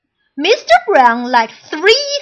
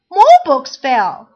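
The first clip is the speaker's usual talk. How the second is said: ordinary delivery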